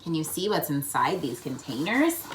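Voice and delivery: ordinary delivery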